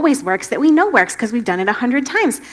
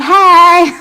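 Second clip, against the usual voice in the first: loud, high playful Hey; cheerful greeting; 7 semitones higher, 10 dB louder than usual. Retold or imitated. Jesus appearing to the hiding disciples with a loud cheerful greeting. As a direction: pop in with a bright call. Jesus popping through the wall.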